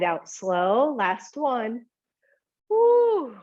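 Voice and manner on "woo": high, loud whoop of effort; excited whoop. Real, not acted. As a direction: let out a happy woo after the hard set. Effort whoop during exercise.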